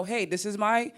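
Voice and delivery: ordinary delivery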